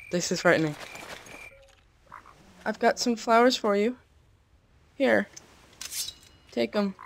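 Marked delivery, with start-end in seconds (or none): 2.6-6.9 mock sweet offering. Real, not acted. Offering flowers to a mob.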